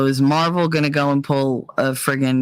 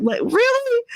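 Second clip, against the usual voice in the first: incredulous. Real, not acted. Disbelief at his claims.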